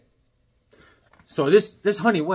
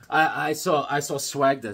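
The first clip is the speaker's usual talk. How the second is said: ordinary delivery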